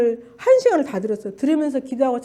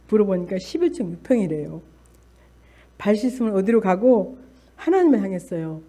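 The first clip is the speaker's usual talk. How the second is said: usual speaking voice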